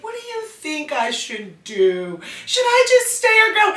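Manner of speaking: hammed-up innocent aunt; mock-naive aunt. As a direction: act gullible asking a kid for advice. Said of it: "I was like".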